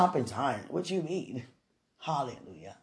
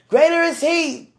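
slow, high, louder proclaiming; scripture declaration; 7 semitones higher, 7 dB louder than usual. Real, not acted. To viewers, slow loud preached verse.

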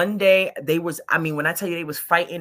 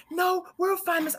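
woman insisting, pitched up; higher voice; 10 semitones higher than usual. Retold or imitated. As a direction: brush it off, pitched high. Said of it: "She's like".

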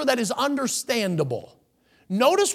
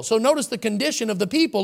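normal talking voice

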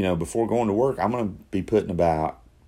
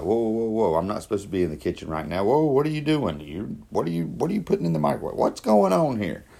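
higher-pitched mock alarm, questions tumbling out; alarmed brain talk; 3 semitones higher than usual. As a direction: play your own brain protesting in baffled disbelief. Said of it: "My brain was like".

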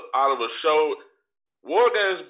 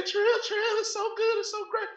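high mock fan gushing; 4 dB quieter than usual. Imitating fans who praise AEW to him, in a high gushing voice. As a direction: gush like a starstruck fan, high up. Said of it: "they say".

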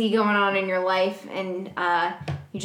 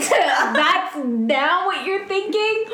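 exasperated; 5 semitones higher, 4 dB louder, slower than usual. Real, not acted. Exasperated scolding.

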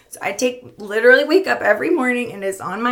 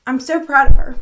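proud, choked up and louder; tearful; 2 semitones higher, 9 dB louder than usual. Retold or imitated. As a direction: fight back tears on a proud quote. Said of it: "can't say without crying".

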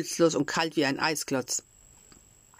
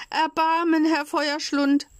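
tearful pleading; 11 semitones higher, 4 dB louder than usual. A narrator's reading. Puppet begging the director for mercy, high and tearful. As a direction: plead for mercy, near tears. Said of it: "weinte und flehte".

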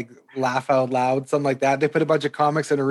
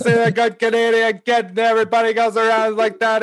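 a lightly done Don Cherry, a bit higher; Don Cherry impression; 6 semitones higher than usual. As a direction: patriotic old commentator going on, loosely imitated. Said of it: "he talked like this".

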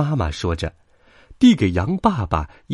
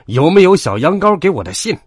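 loud, high-pitched angry bellow; roaring wolf; 9 semitones higher, 8 dB louder than usual. A narrator's reading. Old wolf demands his letter at the post office, roaring. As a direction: roar the question like an impatient wolf. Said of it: "他大吼着".

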